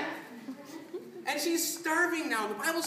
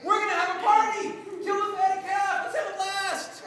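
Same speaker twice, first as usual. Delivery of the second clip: higher-pitched, celebratory announcement; excited father; 4 semitones higher than usual. Acted. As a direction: call everyone to the party, excitedly. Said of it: "He gets so excited".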